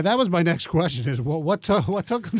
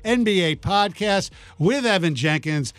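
put-on booming kids show opener; 5 semitones higher than usual. Host opens show like announcer.

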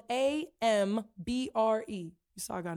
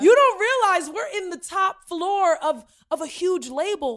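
raised, emphatic retold telling-off; exasperated scolding; 5 semitones higher, 7 dB louder than usual. Daughter to mom at a huge label, exasperated scolding. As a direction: scold Mom for not realizing.

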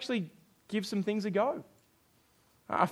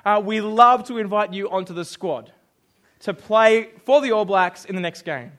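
coach on phone; 9 dB louder than usual. Retold or imitated. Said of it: "Steve Hansen's calling you up".

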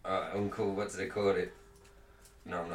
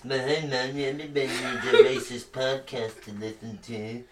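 mocking impression. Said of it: "used to do the voice to Droopy D".

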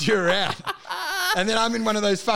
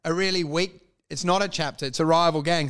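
normal talking voice

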